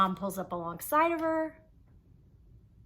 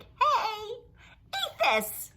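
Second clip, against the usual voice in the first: much higher, sing-song mock offering; mocking mom; 12 semitones higher, 5 dB louder, much slower than usual. Child mocking mom handing out cookies, high mock cheer. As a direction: parody a chirpy stranger pushing food.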